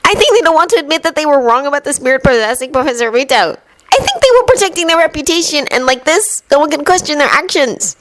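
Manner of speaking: put-on earnest boyish reasoning; Neville voice. A narrator's reading.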